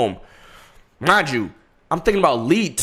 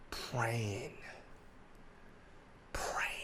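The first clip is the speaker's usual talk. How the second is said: whispered; 7 semitones lower, 23 dB quieter than usual. Shown as a demonstration. Echoing his own prayer to the friend as a whisper. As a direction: whisper the word Praying. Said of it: "I was praying".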